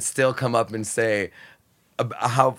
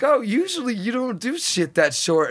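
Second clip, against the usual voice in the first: mock friends' remark; 8 semitones higher than usual. People comment after.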